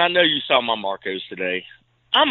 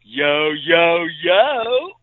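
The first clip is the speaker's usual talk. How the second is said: exaggerated hype greeting; 3 semitones higher, 3 dB louder, much slower than usual. Co-host mocks what he supposedly says, rising hype call. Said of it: "I don't say".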